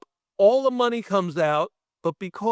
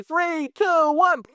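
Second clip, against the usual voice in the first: higher, louder countdown building up; 13 semitones higher, 4 dB louder, much slower than usual. Retold or imitated. Effects boss counting down to the blast, rising excitement.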